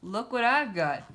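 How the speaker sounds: loud, high call to the cat; shout. A narrator's reading. Captain luring Cat Kong from the plane, loud call. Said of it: "he shouted".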